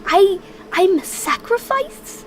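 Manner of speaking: high, stunned outrage at the news; shocked disbelief. Acted. Shocked at being the sacrifice.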